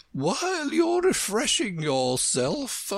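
high, slow, stretched queen voice; brisk, gracious Queen. A narrator's reading. Queen addressing Alice as she eats, high queenly voice. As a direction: announce it high and drawn out.

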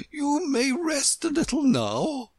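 high, gentle queenly voice; a kind word from the Queen; kindly. A narrator's reading. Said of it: "said kindly".

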